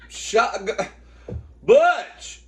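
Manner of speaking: loud, high yell at a dog; scolding the dog. Real, not acted. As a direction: call the dog off sharply.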